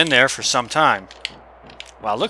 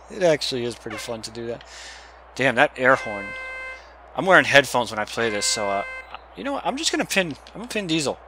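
normal talking voice

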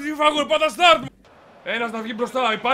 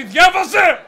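exasperated shout; 4 semitones higher, 7 dB louder, much slower than usual. Real, not acted. Scolds teammate after low instructions.

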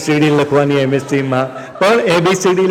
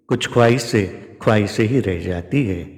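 wistful recitation; 7 semitones lower, 6 dB quieter than usual. Acted.

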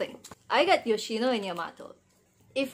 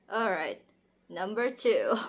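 usual speaking voice

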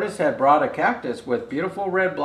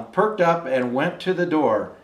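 plain reading voice

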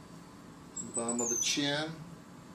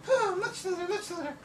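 very high, sweet falsetto damsel; swooning cartoon lady. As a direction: gush like a rescued heroine. Drawing now a lady character.

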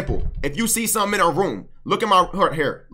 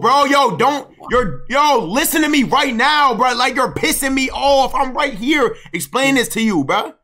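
annoyed; 8 semitones higher, 4 dB louder than usual. Real, not acted. Frustrated friend scolding.

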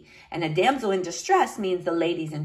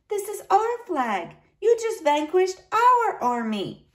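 put-on high, light little-girl voice; little niece, matter-of-fact; 11 semitones higher than usual. A narrator's reading. Niece telling uncle about the flag, high child's voice.

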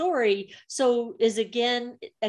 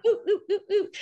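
high, laughing oohs; excited cooing oohs; delighted oohs; 9 semitones higher, faster than usual. Retold or imitated. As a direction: coo at the juicy story. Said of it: "Now I'm like".

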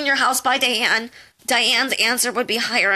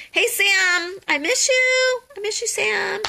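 sing-song calling; 12 semitones higher, slower than usual. Real, not acted. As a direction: call out longingly to Sam. Calling out to a friend.

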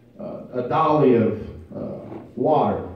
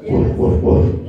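voiceless woof woof woof, a bit louder; breathy dog barks; 6 dB louder than usual. Retold or imitated. Preacher telling a joke, voicing the dog's breathy barks. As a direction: play the dog barking its order, half laughing. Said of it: "And he".

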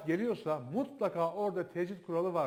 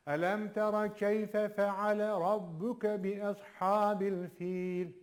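slow chanted recitation; Quran recitation; 2 semitones higher, much slower than usual. Shown as a demonstration. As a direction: recite the verse slowly, with tajweed. Repeats the verse. (yavaş tecvidli tilavet)

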